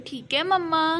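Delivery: put-on high child-like prompt. Shown as a demonstration. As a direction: model the line for the toddler. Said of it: "बोलो".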